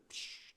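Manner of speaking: a breathy psh of a burst; unvoiced explosion hiss. Pastor imitating lightning blasting a tree, unvoiced psh. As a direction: blow it out like a tree splitting.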